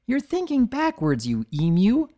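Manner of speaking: high squeak of a little filly; teasing squeak; squeaky. A narrator's reading. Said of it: "Squealy Belle squeaked".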